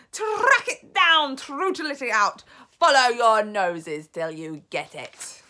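slow, very loud command, starting high; screamed orders. A narrator's reading. Grand High Witch ordering her witches to track, screaming. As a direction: scream the hunt on, drawing words out. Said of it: "screamed the Grand High Witch".

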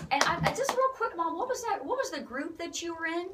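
high, eager call across the room; playful, high-pitched question; excited calling over. Real, not acted. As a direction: call out to Mom, pitched well up. Calling over to Mom off camera.

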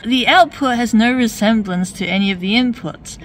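ordinary delivery

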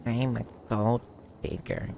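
soft, halting mock girl voice; hushed mocking imitation; April impression. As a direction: tease with a put-on gold-digger voice. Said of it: "I don't sound like that".

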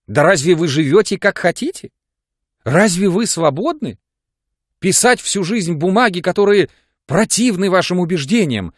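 высоко и громко, с отчаянием; отчаянный горячий упрёк; в отчаянии. A narrator's reading. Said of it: "в отчаянии всплёскивая руками". (с отчаянием упрекает Жоржа, высоко и громко)

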